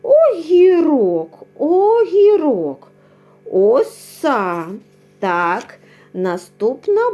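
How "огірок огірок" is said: «огірок» slow, high and loud, O drawn out; sounded out. Shown as a demonstration. Teacher sounds out «огірок» slowly for the class. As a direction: stretch «огірок» so kids hear the O. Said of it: "На звук О починається".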